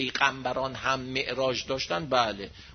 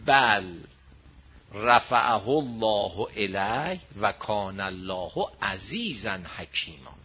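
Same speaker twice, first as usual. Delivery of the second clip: slow, measured chanting of the Arabic verse; Quran recitation; 8 semitones lower, much slower than usual. Acted. Preacher to congregation, recites the verse slowly and solemnly. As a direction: intone the verse slowly like a preacher quoting scripture.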